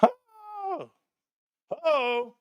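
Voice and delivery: hyped gasps trailing off; excited gasps. Shown as a demonstration. Vlogger showing his excitement, fading gasps. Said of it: "That's what I do".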